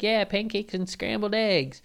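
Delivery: put-on high, innocent boy reply; little kid voice. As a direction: answer like an obedient small kid. Kid answers dad.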